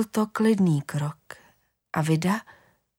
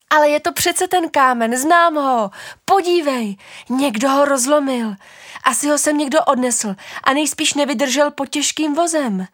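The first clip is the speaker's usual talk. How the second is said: put-on high, louder eager child voice; insistent child; 7 semitones higher, 5 dB louder than usual. Child insists to father it is the stone, high eager child voice. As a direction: insist like a kid who knows. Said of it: "řeklo dítě".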